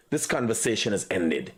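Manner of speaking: curt dismissal. Retold or imitated. Acting out the critic's curt dismissal.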